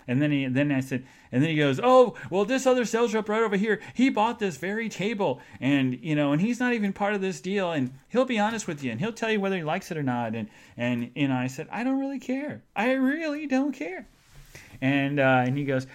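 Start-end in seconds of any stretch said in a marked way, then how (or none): 1.8-10.3 high, loud, eager sales patter; imitated salesman. Salesman dodging the discount question, high eager imitation. Acts out chatty salesman deflecting. 11.7-14.1 high-pitched, emphatic mock protest; retold exasperation. Customer brushing off the salesman, retold high exasperation. Acts out own exasperated retort.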